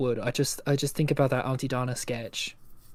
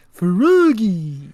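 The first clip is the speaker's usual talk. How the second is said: louder, higher dopey cartoon voice; Big the Cat; 4 semitones higher, 7 dB louder than usual. Doing a favorite impression, dopey cartoon cat voice. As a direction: call it like a big dim cat. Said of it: "a fun impression for me".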